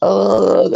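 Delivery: high breathless ugh; panicked grunt. Retold or imitated. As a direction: gasp it out in terror. Pointing to feet, panicked.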